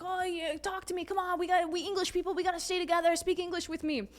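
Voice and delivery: high-pitched mock British pestering; angry British. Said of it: "like, angry British".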